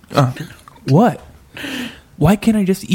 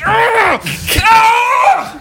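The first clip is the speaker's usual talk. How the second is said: loud high scream; 7 dB louder than usual. Acted. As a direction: scream in panic. Scene ending scream.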